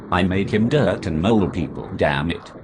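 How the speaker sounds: ordinary talk, nothing marked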